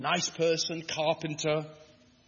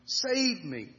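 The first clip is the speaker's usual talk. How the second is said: crying out; 8 semitones higher, slower than usual. A narrator's reading. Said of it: "cried out".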